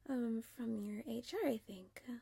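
normal talking voice

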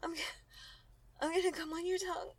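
fast high urgent moan. Acted. Nearing climax.

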